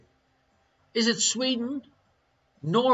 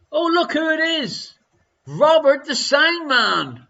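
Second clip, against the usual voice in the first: delighted greeting; 8 semitones higher, 5 dB louder than usual. Real, not acted. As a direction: greet a familiar arrival with a raised, excited voice. Host greets a newly arrived regular.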